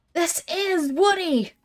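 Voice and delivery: urgent exclamation. Acted. Player urging captive Woody to flee, urgent. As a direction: cry out to send a friend running.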